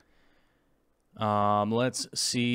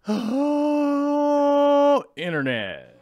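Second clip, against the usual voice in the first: excited greeting; 6 dB louder, much slower than usual. Real, not acted. Stream intro greeting, stretched.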